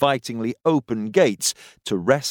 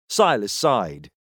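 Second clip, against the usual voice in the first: ordinary delivery, nothing marked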